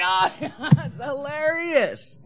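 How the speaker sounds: high, slow mock-laughing praise; drunk audience laughing. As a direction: drunk fan cackling at nonsense. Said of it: "They're like".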